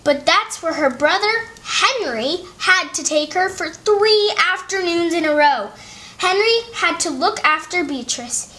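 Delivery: plain reading voice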